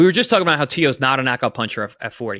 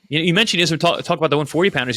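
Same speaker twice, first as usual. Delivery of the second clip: usual speaking voice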